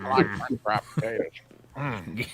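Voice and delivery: quick Southern character voice, grunt at the end; Sling Blade impression. Host trading Sling Blade quotes, Southern character impression. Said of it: "Sling Blade?".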